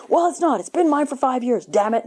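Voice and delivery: mock irate neighbor. Angry neighbor replies.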